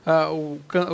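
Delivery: ordinary delivery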